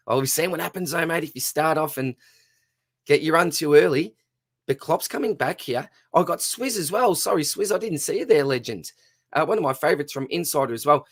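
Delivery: quick, lifted race-calling voice; race commentary. Acted. Host calling the marble race for channel members, excited play-by-play. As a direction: call the race home like a track announcer.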